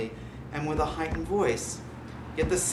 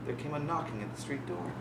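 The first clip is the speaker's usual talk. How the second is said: plain reading voice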